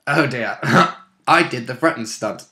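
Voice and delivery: ordinary delivery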